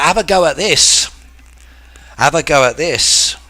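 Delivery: mock Aussie mate shouting have a go. Teacher imitating Aussie slang to chat, mock Aussie shout. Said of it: "we use avo another way, mates".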